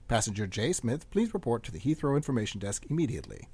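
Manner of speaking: calm, measured PA announcer read; airport announcement. Acted. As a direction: read it like a terminal tannoy.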